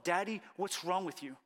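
put-on high-pitched pleading, as a hurt little girl; imitating a child's desperate protest; distressed child. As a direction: plead up high, betrayed by Daddy. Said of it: "to a look of".

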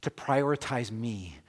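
God gently questioning his son, hushed and tender; gentle voice of God. Retold or imitated. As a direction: speak tenderly, like a loving father.

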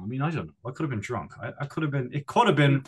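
ordinary delivery

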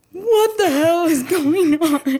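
high, loud, drawn-out disbelief; stunned yelp, stretched out; exceptionally surprised. Retold or imitated. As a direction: re-enact being utterly stunned, slowing each word. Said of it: "You were exceptionally surprised".

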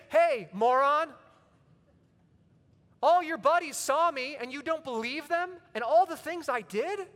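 high, loud, scornful jeer; mock insult. What Jesus never said to Thomas, mocking and loud. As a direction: sneer at him like a bully. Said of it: "he doesn't say".

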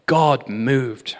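usual speaking voice

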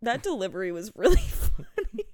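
retold candor dissolving into a laugh; breaking into laughter. Admitting the dog confession was funny, breaking into laughter. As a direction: start straight, crack up near the end. Breaks up retelling own reply.

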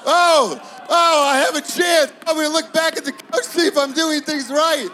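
put-on high, loud, fast excited kid's voice; a first grader thrilled to get a chance; shouted eager kid, rushing the end. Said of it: "He's like".

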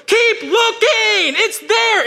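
high, slow, loud insistence; mock shout. Host shouting down to the starving man, high and slow. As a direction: bellow it slowly and high, unbudging.

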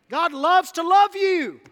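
slow triumphant shouted climax; preaching shout. Real, not acted. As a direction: belt it out to the back row. Climax of sermon list.

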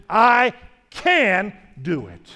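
slow, loud, drawn-out proclamation; slow and loud, every word weighed; emphatic declaration. Real, not acted. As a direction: plant each word like a promise. Preacher's declaration of faith.

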